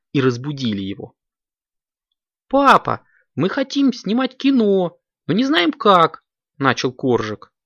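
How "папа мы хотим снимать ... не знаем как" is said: put-on high, loud little-kid voice; high-pitched childlike request; pleading kitten child. A narrator's reading. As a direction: call out high like an eager child to dad. Kitten wakes dad with request.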